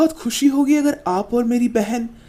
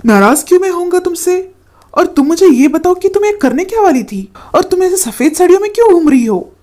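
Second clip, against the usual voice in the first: upset shout; 7 semitones higher, 9 dB louder than usual. Acted. Brother finds sister at the pond.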